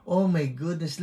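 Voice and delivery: ordinary talk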